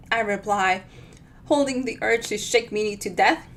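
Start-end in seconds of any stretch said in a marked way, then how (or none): none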